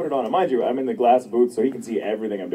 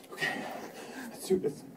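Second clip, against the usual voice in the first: quiet resolve; 14 semitones higher, 13 dB quieter than usual. Retold or imitated. Steeling herself for a hard scene.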